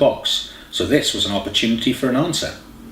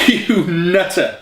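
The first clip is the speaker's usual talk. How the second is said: slow, high, fond laugh-line; hugging his girlfriend, laughing; laughing fondly; 8 semitones higher, much slower than usual. A narrator's reading. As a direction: tease her with a laugh in the voice. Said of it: "Jake laughed and hugged her".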